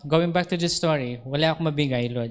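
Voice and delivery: ordinary talk, nothing marked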